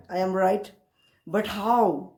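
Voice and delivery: ordinary delivery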